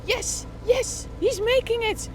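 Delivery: high, loud victory call; excited cheer. Acted. Commentator cheers the courier beating the light, loud and high. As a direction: cheer him across the line.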